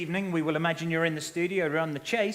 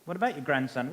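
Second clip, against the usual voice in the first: normal talking voice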